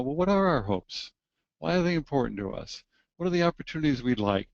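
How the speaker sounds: normal talking voice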